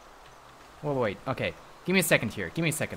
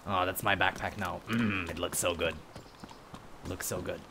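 a lower, softer gloating robber voice; 9 semitones lower, 10 dB quieter than usual. Acted. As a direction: savour the loot like a cartoon thief. Gloating over the stolen backpack.

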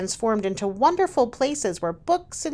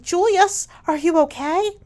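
high, loud worried cry to her son; mother running to her son, alarmed and loud; alarmed call; 9 semitones higher, 6 dB louder than usual. Acted.

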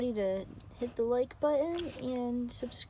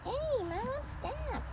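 playful scolding; 5 dB quieter, slower than usual. Real, not acted. Talking to the dog.

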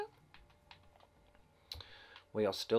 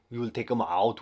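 louder, punchier mock German accent; 6 dB louder than usual. Gamer restating the takedown in louder mock German accent. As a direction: bark the plan in a hammed-up German accent. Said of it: "that weird German voice".

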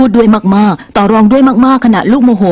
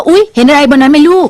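surprised pointing out; 4 semitones higher than usual. Shown as a demonstration. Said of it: "ชี้ไปที่ต้นไม้แล้วบอกว่า".

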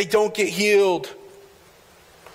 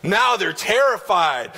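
ordinary talk

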